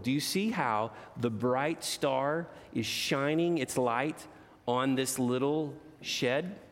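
plain reading voice

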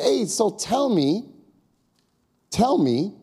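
inviting, drawn-out "Hey, so tell me"; friendly prompt. Shown as a demonstration. Demonstrating to the audience, a friendly inviting question. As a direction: coax them to open up. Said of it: "You just say to somebody".

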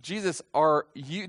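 slow, with "you" lifted; stress on YOU. Shown as a demonstration. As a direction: hold and raise "you" pointedly. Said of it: "do you see what I'm emphasizing here".

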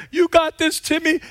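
high, loud encouragement; cheering from the stands. Retold or imitated. Mom and grandma cheering Timmy, loud high call. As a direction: call out to the batter. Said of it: "Mom was over there".

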